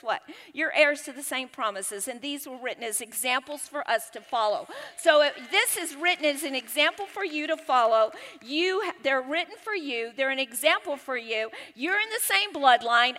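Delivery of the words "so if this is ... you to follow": ordinary talk, nothing marked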